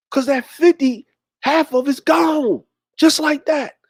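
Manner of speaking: put-on high, dismayed boyish complaint; upset kid. Son complains half his fifty is gone, high and upset. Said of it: "I was getting upset".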